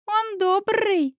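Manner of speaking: put-on high, slow little boy showing off his R; small child stretching the words; funny child drawl. A narrator's reading. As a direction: draw it out with a proudly rolled R. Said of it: "забавно протянул Ваня, акцентируя внимание на букве".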